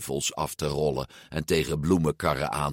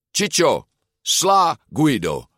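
raised, drawn-out order; commanding; 7 semitones higher, 4 dB louder, slower than usual. A narrator's reading. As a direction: order your henchman about. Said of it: "beval Ercole". (bevelend, met verheven stem)

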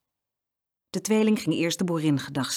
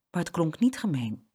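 ordinary delivery

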